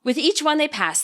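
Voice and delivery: ordinary delivery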